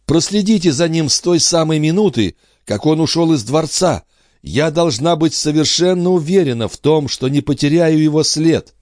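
high-pitched, insistent female character; urgent mother. Acted. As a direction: implore him urgently in a high voice. Андре взволнованно просит. (мать настойчиво просит, высоким голосом)

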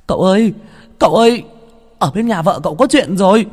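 high, loud alarmed servant; breaking urgent news at a yell; urgent calling out. Acted. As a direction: shout for your master as you rush in. Servant runs in calling his master.

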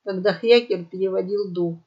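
usual speaking voice

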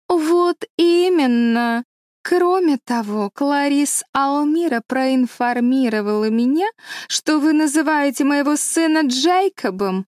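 slow, high-pitched indignant grandeur; pompous lady visitor. A narrator's reading. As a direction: draw out each grievance haughtily. Pompous visitor airing next grievance.

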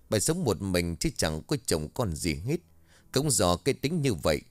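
ordinary delivery, nothing marked

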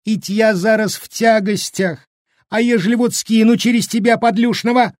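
high, howling peasant woman's lament; wailing. A narrator's reading. Pregnant village woman blaming the cook, wailing. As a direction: wail it in aggrieved complaint. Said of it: "и с подвывом заголосила".

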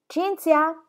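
chiama Cinzia ad alta voce, acuto; chiamata. Acted. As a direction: grida il nome dall'altra stanza. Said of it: "chiamò missus Gibson". (madre chiama la figlia a voce alta)

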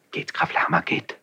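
soft, hushed send-off; whispered dismissal. Acted. Prince sends the count away in a whisper. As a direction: urge him away under your breath.

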